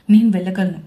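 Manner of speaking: loud, curt retort; snapping. A narrator's reading. Cousin snaps at the maid, curt and loud. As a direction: shake off the maid's help.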